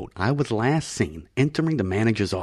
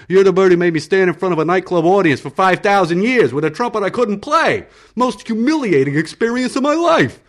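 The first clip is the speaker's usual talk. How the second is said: higher and louder, rising outrage; indignant rant; 10 semitones higher, 7 dB louder than usual. Acted. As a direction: vent the old humiliation. Frank indignant about humiliation.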